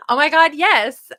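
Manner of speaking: a high, loud burst of real laughter, then a slow gushing "oh my God, yes"; squealing laugh. Real, not acted. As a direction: laugh out loud with delight, then gush the words slowly. Delighted by a Clueless chat comment.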